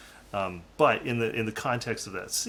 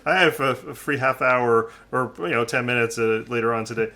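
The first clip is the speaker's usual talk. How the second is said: louder, higher, giggling through a fake invitation; laughing mock come-on; 4 semitones higher, 8 dB louder than usual. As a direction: play the smooth pickup while laughing. Mock flirting as Picard, laughing.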